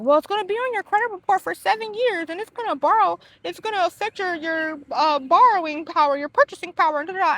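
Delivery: lightly mocking customer-service tone; mock lender voice. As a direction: parody the lender's warning. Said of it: "they're like".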